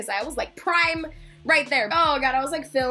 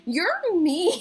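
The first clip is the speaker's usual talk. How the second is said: higher, dissolving into laughter; laughing mock hurt; 5 semitones higher than usual. Fan to dismissive security guard, laughing mock hurt. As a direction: protest playfully, then crack up.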